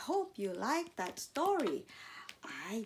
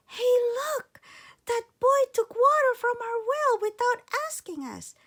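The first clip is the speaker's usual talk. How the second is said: high, loud fairy crying out; a fairy catching a thief, higher and louder; shrill-free high fairy alarm, loud; indignant fairy; 14 semitones higher, 7 dB louder than usual. Acted. Fairy spots the boy.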